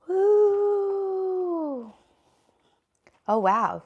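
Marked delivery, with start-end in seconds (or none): 0.1-1.9 long high "woo"; high party cheer; celebratory whoop. Real, not acted. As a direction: let out a drawn-out whoop, falling at the end. Cheering the new year.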